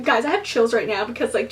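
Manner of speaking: usual speaking voice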